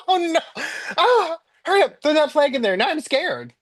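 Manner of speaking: high-pitched, hurried mock panic; comic re-enactment of a frightened "oh no"; retold panic. Retold panic on realizing car's wrong.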